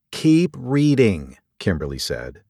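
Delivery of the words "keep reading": slow, higher, deliberate order; firm insistence. Acted. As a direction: spell it out to a doubter, drawn out. Docent presses impatient curator.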